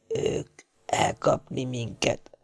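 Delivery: a wounded sailor's fading words; faint dying voice. A narrator's reading. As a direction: let the voice die away. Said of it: "olyan elhaló hangon".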